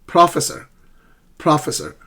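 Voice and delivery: "Professor" wrongly as PRAH-fessor; mis-stressed PRAH-fe-sor; students' misplaced stress. Retold or imitated. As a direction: imitate the error, punching PRO in "Professor". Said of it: "they keep calling me".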